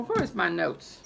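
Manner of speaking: ordinary talk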